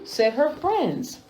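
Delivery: usual speaking voice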